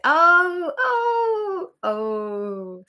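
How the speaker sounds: high, loud hooting "oh, oh" laughter; hammed-up belly laugh; mock guffaw. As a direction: imitate an audience cracking up. Mimicking old audience laughing.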